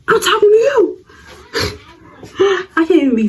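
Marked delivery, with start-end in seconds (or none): 0.1-1.0 high laughing challenge; mock yell. Confronting the rival girl in the hallway, high laughing yell. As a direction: shout it back half laughing.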